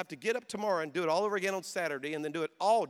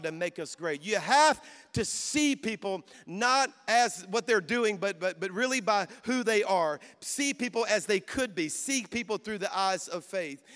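preaching at full force; 2 semitones higher, 4 dB louder than usual. Real, not acted. Pastor preaching to young people, building into a loud cry. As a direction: lift the crowd, preach it hard.

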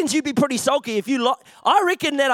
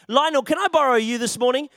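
ordinary talk

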